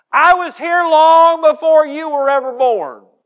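put-on high, loud, puffed-up old churchgoer boasting; pompous church elder. Preacher mocking a boastful church member, loud and high. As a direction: ham up the self-important church member. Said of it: "It would sound like this maybe in a Baptist church".

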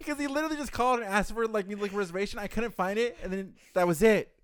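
ordinary talk

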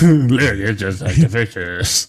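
slow, loud, breathy-sounding seductive mom; sultry mom voice. Acted. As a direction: purr it out slowly like a sultry mom. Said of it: "needs to have a sexy, sultry voice".

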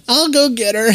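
high, bright mom voice on the phone; girlfriend's mom. Retold or imitated. Mom answering the phone to her daughter's boyfriend, high and cheery. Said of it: "your girlfriend's mom would say".

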